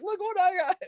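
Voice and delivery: high, gleeful show-off voice; bragging excitedly. Retold or imitated. Showing schoolmates his [name] pick, high gleeful bragging. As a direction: crow it up high like a thrilled kid. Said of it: "I was like".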